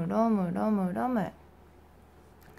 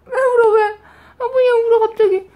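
higher, louder startled asking; alarmed; 11 semitones higher, 8 dB louder, faster than usual. Real, not acted. Mother startled by baby's sudden crying, higher louder. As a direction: rush to ask why she cries.

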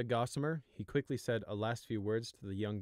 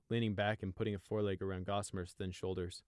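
plain reading voice

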